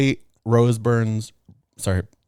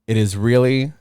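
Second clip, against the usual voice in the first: ordinary delivery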